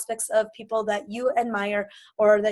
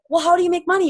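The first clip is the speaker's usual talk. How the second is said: higher, louder curious friend; eager question; 6 semitones higher, 7 dB louder, faster than usual. Retold or imitated. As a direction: ask like you're hooked already. Imagined prospect asking eagerly.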